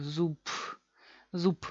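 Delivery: zub as zup, with a puff of p; devoiced final B. Shown as a demonstration. Teacher showing the tired ending, devoiced. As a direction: end zub on a soundless P. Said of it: "Así, sin sonido".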